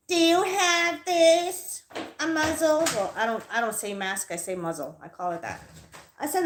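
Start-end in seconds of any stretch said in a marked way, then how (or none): 0.1-2.8 high, drawn-out mocking imitation; slow, raised-pitch demand, mimicking her; mimicked desk clerk. Acts out clerk behind the glass.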